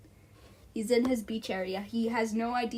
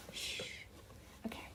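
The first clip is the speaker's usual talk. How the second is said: usual speaking voice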